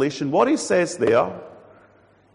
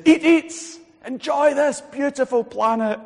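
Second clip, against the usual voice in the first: high generous urging; 10 semitones higher, 4 dB louder, slower than usual. Retold or imitated.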